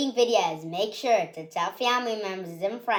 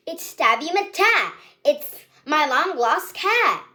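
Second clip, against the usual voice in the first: excited; 8 semitones higher, 5 dB louder than usual. Acted. Busker finds his lost cat.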